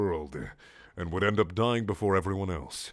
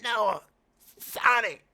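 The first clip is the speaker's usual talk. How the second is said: much higher, frail squeaky plea; dying voice; 12 semitones higher, slower than usual. Acted. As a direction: gasp it out like dying. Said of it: "in still a slow dying way".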